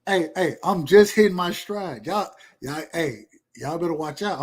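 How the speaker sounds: ordinary delivery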